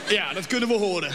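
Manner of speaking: sneering remark breaking into laughter; mocking juror. Juror mocks the boy's accent, laughing.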